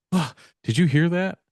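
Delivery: mock startled gasp and urgent question. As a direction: act out a sudden fright mid-chat. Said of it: "all of a sudden you just jump".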